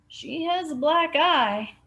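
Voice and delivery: lightly put-on voice, slow and careful; reluctant news. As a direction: hesitate before the bad news. Barney reveals bad news.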